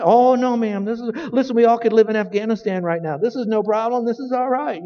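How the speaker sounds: high-pitched, reassuring voice of his wife; wife's reassurance. Retold or imitated. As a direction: lift the pitch and soothe the cashier kindly. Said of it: "Carol said".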